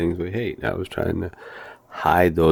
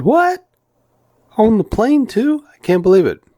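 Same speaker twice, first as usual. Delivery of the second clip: much higher, louder hammed-up disbelief; mock shock; 7 dB louder than usual. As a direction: squeal the fake shock at the flight attendant. Mock shock about the hat.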